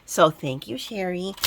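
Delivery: usual speaking voice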